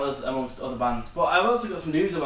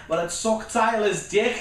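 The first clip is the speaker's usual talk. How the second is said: mock teen girl; 6 semitones higher, slower than usual. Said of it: "And she's like".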